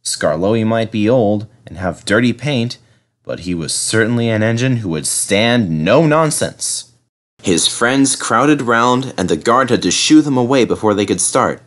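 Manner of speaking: ordinary delivery, nothing marked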